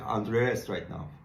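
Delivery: normal talking voice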